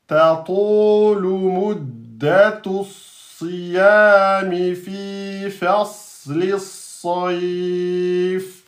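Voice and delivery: whole line chanted very slowly; slow recitation. Shown as a demonstration. Teacher recites the whole line to the class. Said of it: "ça fait quoi tout ça".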